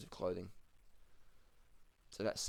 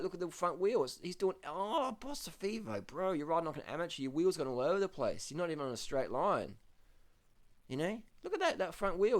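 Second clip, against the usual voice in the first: exasperated; 8 semitones higher than usual. Real, not acted. Ranting at the rider's wobble.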